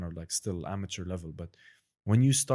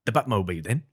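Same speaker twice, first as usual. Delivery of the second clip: louder British Caine voice; Michael Caine impression; 6 dB louder than usual. Friends' favourite party trick, louder Caine accent. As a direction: clip the line like Michael Caine. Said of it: "he does like".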